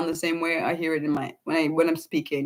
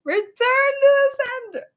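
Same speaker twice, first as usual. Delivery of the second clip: playful, high-pitched button call; push-button announcement; 4 dB louder than usual. Pretend. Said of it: "buttons where you could push return to sender".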